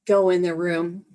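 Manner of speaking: ordinary talk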